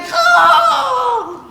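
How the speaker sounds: long, loud, high roar of a beast; wild howling cry. Retold or imitated. Witness imitates the cry that invaded the forest, howling. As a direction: howl out a terrifying animal cry. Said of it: "Un cri qui a soudainement envahi la forêt".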